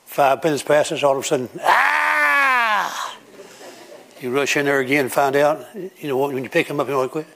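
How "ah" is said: put-on high shrieking baby cry; imitated newborn scream; baby's wail.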